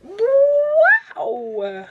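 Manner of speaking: very high, loud, drawn-out wow; amazed wow. Real, not acted. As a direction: squeal a long wow in awe. First look at new palette.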